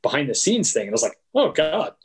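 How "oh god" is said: higher, louder "oh God" reaction; retold shock. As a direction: re-enact a shocked reaction, pitched up. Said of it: "it was very, very shocking".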